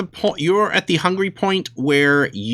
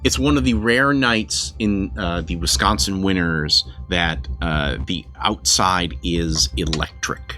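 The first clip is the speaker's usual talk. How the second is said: story narration; 6 semitones lower than usual. Acted. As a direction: set the scene like a storyteller. GM opens the vampire story.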